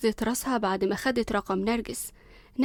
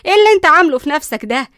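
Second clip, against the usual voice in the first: furious scolding; 9 dB louder than usual. Acted. Nanny scolds the spoiled son, loud furious yelling. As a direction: tear into him like an outraged nanny.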